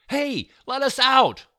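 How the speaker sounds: high, loud panicked scream; trapped girl screaming for help. A narrator's reading. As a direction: scream to be let out of the cage. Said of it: "I screamed".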